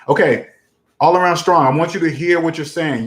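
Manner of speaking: ordinary talk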